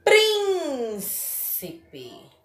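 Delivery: "Príncipe" with very long high PRIN, then ci, pe; stress on first syllable. Shown as a demonstration. Teacher shows antepenultimate stress, "Príncipe" with PRIN stretched. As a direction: draw out the start of "Príncipe", tail off on the rest. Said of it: "prim é a antepenúltima sílaba mais forte".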